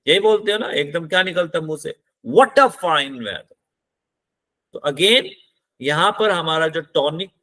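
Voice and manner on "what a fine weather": What punched, voice falls by weather; slow, hit What then let it drop; stress on What, falling tone. Shown as a demonstration. Teacher showing how you exclaim at fine weather, stress on What. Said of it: "weather तक आते हुए आपकी tone गिर जाती है".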